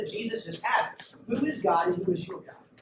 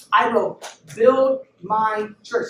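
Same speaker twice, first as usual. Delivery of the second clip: slow, louder, each word driven home; preached climax; 9 dB louder, much slower than usual. Acted. Preacher quoting Jesus to the congregation, slow loud emphasis. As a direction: build it up like a sermon peak.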